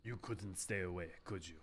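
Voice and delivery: soft, low sneering threat; quiet villain menace. Acted. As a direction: taunt them in a hush.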